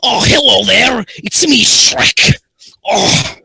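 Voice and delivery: loud, high-pitched ogre impression; hammed-up, shouted ogre greeting; Shrek. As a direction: bellow it out as a big cartoon ogre. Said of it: "Shrek voice".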